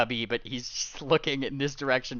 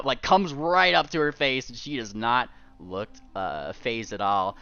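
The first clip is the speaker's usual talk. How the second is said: normal talking voice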